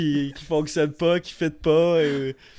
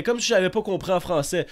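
normal talking voice

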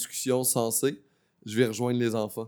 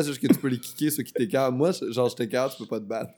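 ordinary talk

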